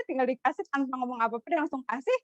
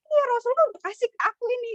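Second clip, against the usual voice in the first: high, quick retold protest; lightly acted, rushed and high-pitched; mock insistence; 12 semitones higher, 4 dB louder, much faster than usual. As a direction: imitate someone eagerly insisting on a claim. Acted insistent claim to the captive.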